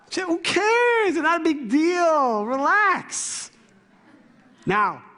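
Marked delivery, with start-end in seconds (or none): 0.1-3.5 high, drawn-out mock brush-off; hammed-up, stretched "relax" reaction; exaggerated dismissal. Husband reacting to an upset wife, exaggeratedly dismissive.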